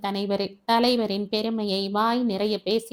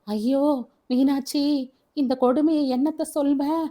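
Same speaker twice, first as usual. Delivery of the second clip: distraught; 5 semitones higher than usual. Acted. Father telling wife of the snake bite, distraught and anguished. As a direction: cry it out in distress.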